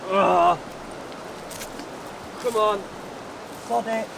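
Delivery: stretching for the rope, cold; straining in cold water. Real, not acted.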